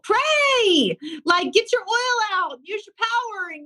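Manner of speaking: high, fired-up urging; rally them to pray with power; all in one breath. Real, not acted. Urging people to pray boldly.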